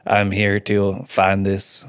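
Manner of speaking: slow imitation of the Preacher's accent; copied accent. Newcomer accidentally echoes the stranger's answer, slow copied accent. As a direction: slip into the other character's drawling accent. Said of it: "I don't know why I copied your accent".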